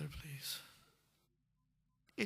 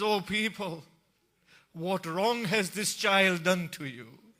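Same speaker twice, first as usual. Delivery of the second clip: anguished cry; 8 semitones higher, 11 dB louder than usual. Acted. Hussein holds up the child and begs the army, anguished shout. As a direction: plead with the whole army at full voice.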